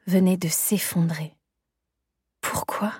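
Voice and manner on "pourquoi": breathy despairing whisper; broken whisper. Acted. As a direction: whisper it, crushed. Despair after rejection.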